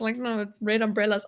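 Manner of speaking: ordinary talk, nothing marked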